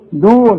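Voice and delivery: دون with d opening straight into long u; no echo on the د of دون; no qalqalah. Shown as a demonstration. Teacher showing the second dal, unbounced دون. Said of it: "مفيش قلقلة هنا".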